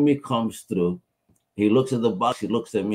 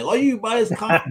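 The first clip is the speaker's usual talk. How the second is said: high mock spouse; 10 semitones higher than usual. Spouse voiced in retold scene.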